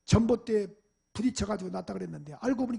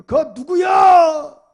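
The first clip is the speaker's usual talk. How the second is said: very loud, slow, higher call into the dark; startled shout; 7 semitones higher, 21 dB louder, much slower than usual. Retold or imitated. 밤늦게 안방의 아버지가 들어오는 사람에게, 놀라 크게 외치는 고함. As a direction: shout it from the bedroom at an unknown intruder. Father shouts from bedroom at night.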